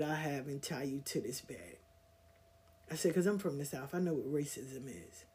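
quiet, low, cold threat; a low warning said through the teeth; low menacing threat. Retold or imitated. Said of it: "But I said it in a, another way".

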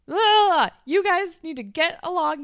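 loud, high, hammed-up interruption; mock exasperated shouting. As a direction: shout over two squabblers, playfully. Panicked manager breaking up a fight.

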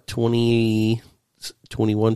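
ordinary talk, nothing marked

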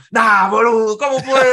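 Argentine friend outraged. Retold or imitated. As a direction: blurt it out like an offended Tucumán fan. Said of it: "él es tucumano".

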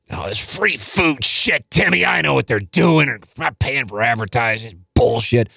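a hint of an irritated dad; dad's voice. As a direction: grumble like an annoyed father. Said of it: "I remember my dad".

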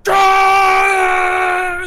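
very high, loud sustained cheer; crowd roar. Acted. Game master imitates the crowd cheering, huge roar. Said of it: "And the crowd goes".

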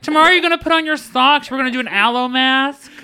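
high, loud, giggly diva assistant; sassy phone assistant talking down to its owner. Pretend. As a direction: refuse the user like a pampering best friend. Sassy GPS refuses the user.

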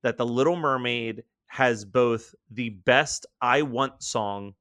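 usual speaking voice

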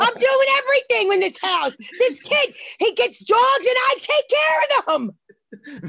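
exasperated hollered complaint; aggravated. Real, not acted. Mother griping about her son's dogs, aggravated holler. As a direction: rant it out, stretching the final words. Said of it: "I'm aggravated".

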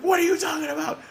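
mock outrage, high and incredulous; mad Jericho. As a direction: imitate a furious wrestler's outraged protest. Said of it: "It's like".

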